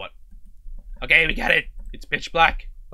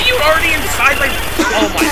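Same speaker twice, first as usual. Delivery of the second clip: high, loud frightened cry; startled scared yell; 9 semitones higher, 5 dB louder than usual. Real, not acted. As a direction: yelp in sudden fright. Scared by animatronic.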